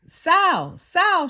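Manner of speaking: calling Sal loudly; shouted. A narrator's reading. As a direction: shout his name across the shop. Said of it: "shouted Wanda Lynn".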